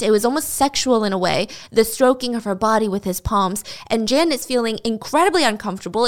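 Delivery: normal talking voice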